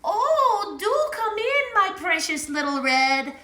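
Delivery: high, put-on granny voice; a monster truck playing sweet old granny; truck faking Granny voice. A truck pretending to be Granny greets the little scooter sweetly, high and put-on. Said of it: "cackled Tank in his best Granny voice".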